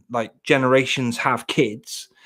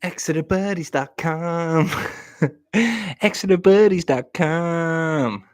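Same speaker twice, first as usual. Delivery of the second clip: slow, higher jingle-like voice, "Exeter buddies dot com"; mock announcer; 5 semitones higher, slower than usual. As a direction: read it like a cheesy web ad. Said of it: "She's Ex-, Exeter Buddy".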